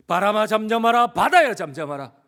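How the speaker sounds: commanding rebuke. Acted. Jesus ordering wind and sea to be still, rebuking. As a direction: rebuke the storm at full voice. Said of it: "자연 만물을 꾸짖고".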